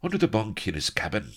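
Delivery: quick, high gasped confession. A narrator's reading. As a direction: blurt it out in a gasp. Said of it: "gasped Blood".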